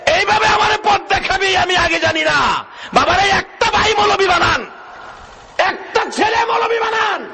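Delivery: very high preacher's shout; impassioned crying out. Retold or imitated. Weeping brother crying out to the cleric, very high shout. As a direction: cry it out at the top of the range. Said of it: "ভাই কান্দে আর কয়".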